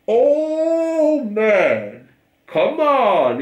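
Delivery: high drawn-out "oh" of protest; amused exasperation. Real, not acted. Protesting at a post, high exasperated "oh, man".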